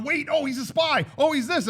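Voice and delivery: high, excited exclamations; mock alarmed guessing. Acting out the film's accusations, high mock alarm. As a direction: blurt it out high, as if just realizing. Voicing viewers jumping to conclusions.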